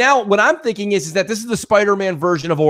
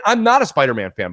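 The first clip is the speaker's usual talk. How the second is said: usual speaking voice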